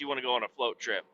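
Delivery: low, rushed aside answering yourself; quick confirmation. Shown as a demonstration. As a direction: toss it off as settled. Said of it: "Fuck yes".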